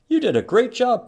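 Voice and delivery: high, excited praise; Mom's praise. A narrator's reading. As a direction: gush over the child's work. Mom praising Pete in story.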